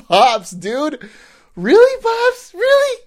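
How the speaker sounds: very high, laughing "really, Pops"; laughing teasing. Retold or imitated. Son-in-law laughing at father-in-law's shrug, very high teasing. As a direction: tease him through laughter.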